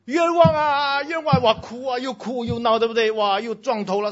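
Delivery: high, loud wail of a wronged man crying injustice; hammed-up cry of injustice; mock wailing. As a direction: act out the falsely accused howling. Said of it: "被冤枉的人会怎样".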